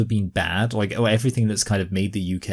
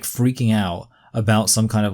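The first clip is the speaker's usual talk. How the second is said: ordinary delivery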